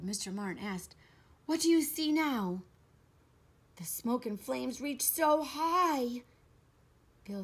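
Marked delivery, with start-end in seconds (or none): 1.5-2.6 high, loud anxious question; raised, pressing character voice; urgent question. Acted. Anxious question nearing the fire. 3.8-6.2 slow, raised lament; slow, high, woeful report; dismayed sadness. A narrator's reading.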